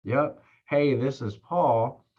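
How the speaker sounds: unhurried, slowed-down phone opener; slow delivery. Shown as a demonstration. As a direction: take your time, match a slow caller. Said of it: "You see how much slower that is?".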